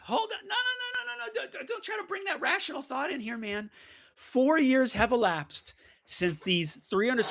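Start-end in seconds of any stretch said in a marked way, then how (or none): 0.0-3.7 high, hammed-up protest, brushing off logic; mock outrage. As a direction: playfully wave the objection away, pitched way up. Mock outrage at rational objection.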